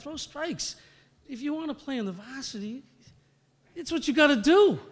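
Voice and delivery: mom lecturing. Retold or imitated. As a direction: lay down the law like mom. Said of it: "She's like".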